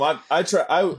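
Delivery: ordinary talk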